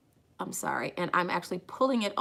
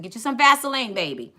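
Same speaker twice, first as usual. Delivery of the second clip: high, loud lively mom; imitating mom; 5 semitones higher, 7 dB louder than usual. Daughter voicing her late mom's advice, high and loud. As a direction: play her mama giving beauty advice. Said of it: "as my mom said".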